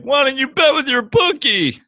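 high, loud mocking voice; whiny mock of a friend; Matty. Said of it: "And he's like".

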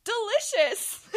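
high, slow gushing delicious; drawn-out delicious. Retold or imitated. Teen on stage praising the chef's rice, high and slow. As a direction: savour it for the crowd. Tasting on stage before crowd.

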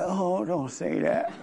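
soft, slow, laughing protest from the barber; half-laughing oh, don't say that; barber's mock protest. Barber telling the speaker not to say it, laughing softly. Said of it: "He's like".

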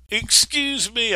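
put-on high, slow small child asking; a small boy politely interrupting; little boy voice. Little boy tugging on Daddy's coat.

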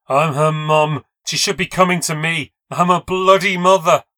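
loud, high raging cry; furious shout. Acted. Rose screams at the narrator on the floor, furious. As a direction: scream out a mother's claim.

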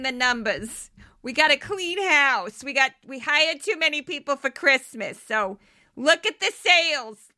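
loud, brassy Bronx boss barking orders; a Bronx diner owner laying down the law; Bronx accent. Retold or imitated. Diner owner Ellen tells Irving to fire staff, loud Bronx accent. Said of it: "this is how she sounded every single day".

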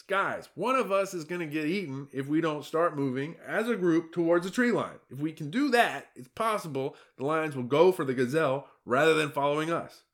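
a fretful zebra pleading to be heard; exasperated herd lookout; alarmed zebra. Acted.

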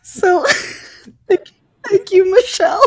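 thanks through real laughter; host still laughing at the reading. Real, not acted. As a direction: laugh while you thank her. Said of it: "laughing after the reading".

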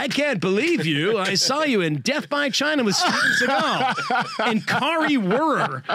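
high, slow hammed-up fan voice with laughter; teasing the film by playing a fan; mock fan gush. Mock fan gushing about movie.

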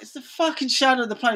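high incredulous correction; mock exasperation. Skate team correcting the kid's shark sighting, mock exasperation. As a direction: imitate fed-up mates setting him straight. Voices group correcting the friend.

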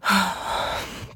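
soft shaky exhale, holding back tears; emotional sigh. Real, not acted. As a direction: let out a tired, emotional breath. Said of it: "this makes me super emotional".

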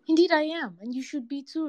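high-pitched, emphatic admission; emphatic warning. A narrator's reading. Hyena admits fear to the cat, emphatic warning. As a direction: start loud and high, stay high.

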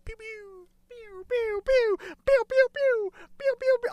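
squeaky laser-gun pews; high-pitched pew noises. Pretend. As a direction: repeat tiny high "pew" zaps over and over. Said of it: "I'll just do this".

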